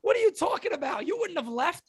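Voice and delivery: high, exasperated disbelief aimed at the manager; can't believe what he's hearing, let it rip up high; incredulous rant. Real, not acted. Podcaster ranting at the manager, high incredulous disbelief.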